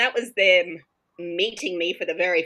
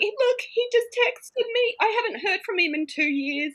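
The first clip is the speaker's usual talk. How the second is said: girl amazed her ex just texted her, high squeaky mock shock; stunned disbelief. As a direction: sound shocked that he actually texted. Girl stunned by ex's text.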